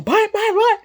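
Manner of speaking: high, loud volley of whats; comic loud, high-pitched outburst; too loud. Retold or imitated. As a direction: blurt it out as a mock yell. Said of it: "who's speaking at a regular tone and you going".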